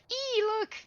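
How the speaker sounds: squealing high voice; high-pitched squeal. Retold or imitated. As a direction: squeal it out excitedly. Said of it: "High pitch E. Squeal".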